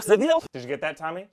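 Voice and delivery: ordinary delivery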